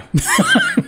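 sudden high burst of laughter; startled high laugh. Real, not acted. Laughs at surprise encounter.

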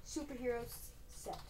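whispered aside; whispering. Real, not acted. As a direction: drop to a whisper. Whispered aside in build intro.

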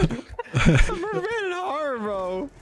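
slow, high, loud complaint; frustrated yell. Real, not acted. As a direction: wail about the lag. Lag outburst in game.

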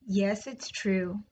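plain reading voice

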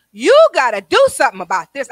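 loud, swooping demand; forceful plea. Retold or imitated. Retelling a desperate demand to God, forceful plea. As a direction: plead loudly, hitting the key words hard.

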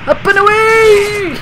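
high, loud, drawn-out launch cry; hammed-up superhero shout; heroic cry. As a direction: holler it long and high.

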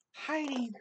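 plain reading voice

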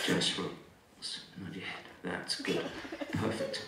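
very soft, low, breath-light murmur; hypnotist's soothing voice. Real, not acted. Hypnotist settling the volunteer, soothing and hushed. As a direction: lull them with hushed, low words.

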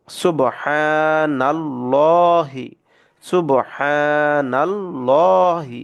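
সুবহানাল্লাহি drawn out very slowly with a thick lam; heavy lam recitation. Shown as a demonstration. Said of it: "এটাকে মোটা করে পড়বো".

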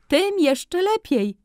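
very high, slow, drawn-out glee; the furrier relishing the news; high, loud stretched exclamation of a delighted salesman. A narrator's reading. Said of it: "wykrzyknął Ankier".

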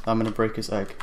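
ordinary talk, nothing marked

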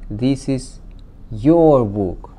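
"your" stressed hard after a small pause. Shown as a demonstration. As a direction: set "your" apart and hit it. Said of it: "has been added for emphasis".

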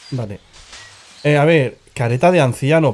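ordinary delivery

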